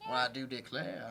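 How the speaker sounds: put-on soft, low Southern belle accent. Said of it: "You got a Southern belle".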